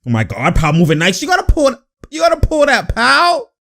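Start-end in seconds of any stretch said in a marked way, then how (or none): 1.2-3.5 high-pitched pleading with a player; excited urging. Real, not acted. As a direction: urge him on in a raised, squeaky pitch.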